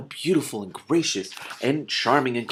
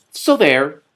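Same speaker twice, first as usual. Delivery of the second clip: put-on loud childish defiance; defiant; 7 semitones higher, 11 dB louder than usual. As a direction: stamp your foot on it. King defiant to council.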